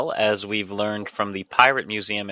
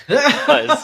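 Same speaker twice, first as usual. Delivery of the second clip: laughing; 14 semitones higher, 4 dB louder than usual. Real, not acted. Laughing at his own joke.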